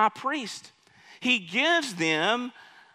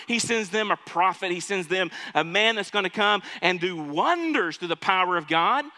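usual speaking voice

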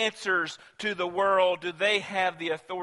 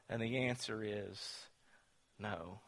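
hushed answer; 8 semitones lower, 12 dB quieter than usual. Acted. Preacher answering his own question, hushed. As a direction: drop to a near whisper for the final no.

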